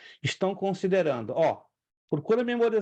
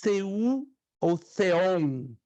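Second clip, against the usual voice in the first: "theos, theou, theon" drawn out slowly; listing declensions for students; slow dictation; much slower than usual. Shown as a demonstration. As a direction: spell out each form slowly. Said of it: "três declinações pra Deus".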